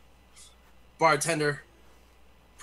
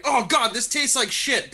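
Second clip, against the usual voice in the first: higher, louder and slower, retching disgust; disgusted outburst; 10 semitones higher, 6 dB louder than usual. Acted. Bar patron biting a cursed apple: loud disgusted outburst. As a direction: recoil at a foul taste. Man bites apple, tastes shit.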